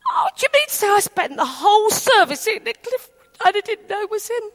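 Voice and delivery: high, rushed retold astonishment; amazed realisation. Friend realising who sat beside her, amazed. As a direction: blurt it out in dawning amazement.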